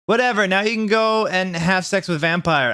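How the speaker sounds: put-on high, sulky teen snap; hammed-up goth tantrum; mock shout. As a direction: throw it at him jealously. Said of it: "I shouted".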